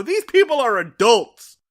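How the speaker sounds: loud, high-pitched disbelief; exasperated outburst. Acted. Podcaster reacting to an embarrassing episode, in loud exasperation. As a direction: raise pitch and volume in appalled protest.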